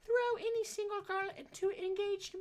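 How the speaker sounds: put-on high, slow, overdone British accent; muddled fake British accent. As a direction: slide into a British accent that goes astray. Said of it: "I don't even know what kind of accent I'm doing there".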